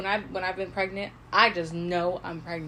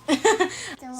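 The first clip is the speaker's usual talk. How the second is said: laughing; 7 semitones higher, 5 dB louder than usual. Real, not acted. Laughing at own confusion.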